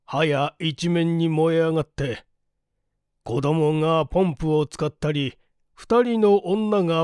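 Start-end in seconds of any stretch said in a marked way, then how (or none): none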